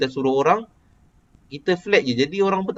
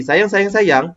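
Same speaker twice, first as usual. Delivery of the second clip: "sayang, sayang, sayang" high and louder, as a query; questioning intonation; 7 semitones higher, 6 dB louder, much slower than usual. Shown as a demonstration. Teacher demonstrating a question, "sayang, sayang, sayang" in high questioning tune. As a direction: ask it with "sayang, sayang, sayang", pitch lifted. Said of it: "Macam tengah bertanya".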